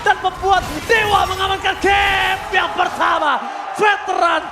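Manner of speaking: loud, high victory call; hype esports caster. Acted. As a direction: scream the game-winning moment. Game-ending climax call.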